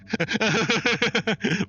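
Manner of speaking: high, rapid run of laughter; helpless giggling. Real, not acted. Streamer amused by own Mountain Dew joke, giggling fit. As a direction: crack up at your own joke.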